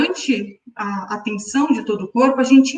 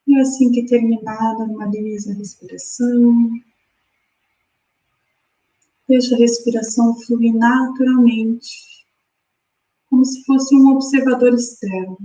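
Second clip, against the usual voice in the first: guided meditation. Acted. Guides breathing exercise.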